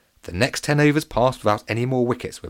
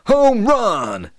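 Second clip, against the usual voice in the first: loud, high triumphant yell; shout; 14 semitones higher, 8 dB louder than usual. A narrator's reading. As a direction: bellow it like a baseball hero. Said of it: "he would shout".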